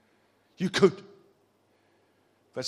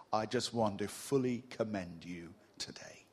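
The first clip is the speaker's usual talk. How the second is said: whispered commendation; 6 semitones lower, 6 dB quieter than usual. Real, not acted. Tender commendation.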